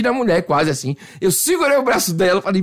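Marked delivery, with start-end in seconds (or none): none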